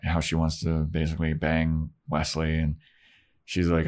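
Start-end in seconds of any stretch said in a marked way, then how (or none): none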